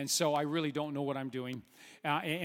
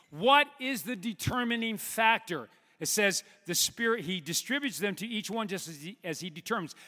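preach mode; 3 semitones higher, 3 dB louder than usual. Real, not acted. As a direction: preach it with rising force. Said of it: "switch into the preach mode".

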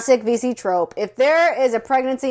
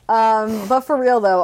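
normal talking voice

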